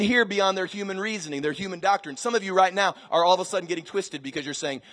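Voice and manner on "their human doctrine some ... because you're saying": ordinary delivery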